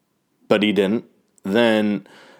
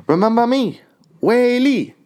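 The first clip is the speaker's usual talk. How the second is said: high, light Chinese-accented voice imitating a fighter; cute imitation with a Chinese accent; 3 dB louder than usual. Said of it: "her little, uh, Chinese accent".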